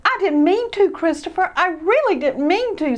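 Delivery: put-on high, earnest apologetic boy; pleading Ted. Ted pleading with Christopher. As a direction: beg him to believe you.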